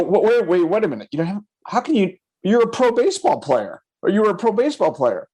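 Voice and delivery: high-pitched, stammering disbelief; incredulous. Retold or imitated. Agent to a pro athlete with no money, incredulous. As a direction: challenge him, pitch climbing on "pro baseball player". Acts out disbelief at broke buyer.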